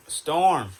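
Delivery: sharp loud call of the dog's name. Real, not acted. Yelling at dog to behave.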